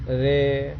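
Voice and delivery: रे held long on one level tone; prolonged pluta vowel. Shown as a demonstration. Teacher showing how someone is called, रे stretched long. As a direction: draw out the vowel of रे as if calling. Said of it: "जैसे किसी को बुलाने में".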